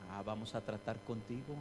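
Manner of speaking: low, soft knowing reply; God calmly accepting the challenge; quietly ominous divine voice; God answering. Acted. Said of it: "dice Dios".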